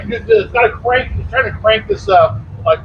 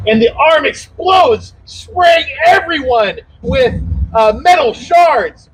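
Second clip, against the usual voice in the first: slow, raised build on the explosion; dramatic narration; 4 semitones higher, slower than usual. A narrator's reading. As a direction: announce the blast with weight.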